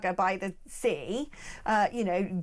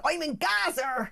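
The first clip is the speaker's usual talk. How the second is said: drawn-out, higher West Country correspondent; West Country accent; 5 semitones higher, slower than usual. Acted. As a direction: parody a war report in West Country accent. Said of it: "you can be a correspondent".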